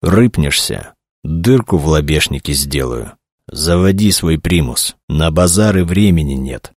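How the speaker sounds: plain reading voice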